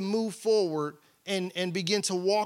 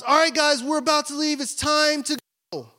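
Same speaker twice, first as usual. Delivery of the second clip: put-on higher, louder call to the kids; 7 semitones higher, 3 dB louder than usual. Wife calling the kids to leave, higher and louder. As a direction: sing out that it's time to leave. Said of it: "she goes".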